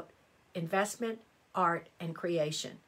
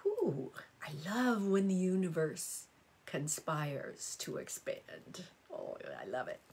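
breathy whisper; 2 semitones lower, 4 dB quieter than usual. Real, not acted. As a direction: whisper it as if savouring a secret. Savouring horoscope line, real delight.

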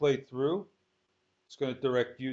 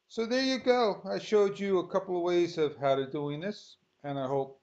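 higher and louder, cheerful sign-off; upbeat wrap-up; 5 semitones higher, 4 dB louder than usual. Real, not acted. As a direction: finish the lesson on a bright triumphant lift. Wrapping up the tutorial triumphantly.

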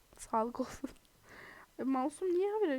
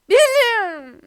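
baffled squeal; 9 semitones higher, 15 dB louder, slower than usual. Real, not acted. Baffled by being teleported.

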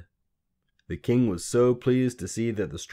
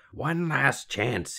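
slow, higher croaked warning; croaking; 7 semitones higher, much slower than usual. A narrator's reading. Little man warning the queen, croaking. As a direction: drag it out in a croak. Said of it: "he croaked".

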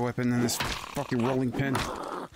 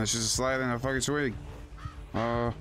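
ordinary delivery

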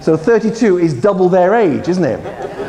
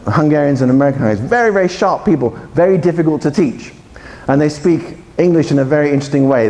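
ordinary talk, nothing marked

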